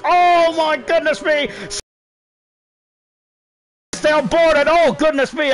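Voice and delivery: overwhelmed by the chaos on track; excited disbelief. Real, not acted. Commentator overwhelmed by chaos on track, excited disbelief.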